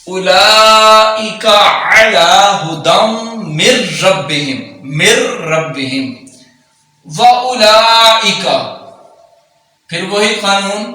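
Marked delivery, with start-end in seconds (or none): 0.1-8.4 slow, drawn-out tajweed chant; Quran recitation. Acted. Teacher recites the verse slowly for students. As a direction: recite slowly, holding every long vowel.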